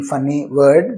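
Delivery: usual speaking voice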